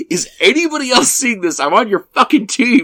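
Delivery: high-pitched indignant protest; exasperated appeal. Retold or imitated. Riled goalie calling to the room for witnesses, exasperated. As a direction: plead with the room in outrage. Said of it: "the call to the, the room".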